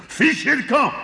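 very high, strident giant yell; enraged yell. A narrator's reading. Enraged giant yells at the farmer to leave. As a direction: screech it in a foot-stamping rage. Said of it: "hurla de nouveau le géant en trépignant de rage".

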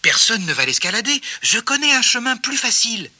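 high-pitched rabbit voice; pacifying rabbit. A narrator's reading. Rabbit leading friends to an easier path, pacifying.

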